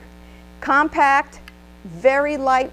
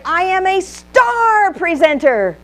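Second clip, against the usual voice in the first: "star" hit high and loud, slower; louder model line; 7 semitones higher, 6 dB louder, slower than usual. Shown as a demonstration. Trainer coaching the workshop, high and loud on "star". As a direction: announce it proudly to the room. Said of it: "turn to the other neighbor and say".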